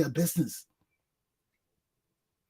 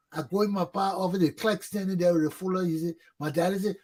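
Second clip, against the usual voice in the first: ordinary talk, nothing marked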